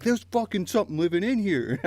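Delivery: high retold alarm. Retelling the stranger at the gas pump, high alarmed imitation. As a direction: squeak it out like the panicked guy at the pump. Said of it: "He's like".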